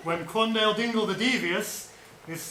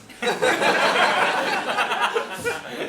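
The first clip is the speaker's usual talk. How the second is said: rising burst of laughter; loud laugh; 7 semitones higher, 5 dB louder than usual. Real, not acted. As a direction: crack up at the joke. Laughing at the Irish vendor.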